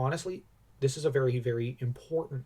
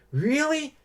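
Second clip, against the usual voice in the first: high, bright eager "Really?"; a boy hungry for more stories; 12 semitones higher, 3 dB louder than usual. Retold or imitated. Boy to Solomon, eager and much higher. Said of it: "and you have the boy".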